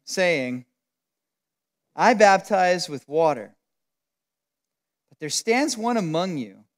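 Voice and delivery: low, measured Bible reading voice; scripture reading. Acted. Reads scripture passage aloud.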